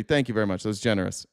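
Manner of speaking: ordinary talk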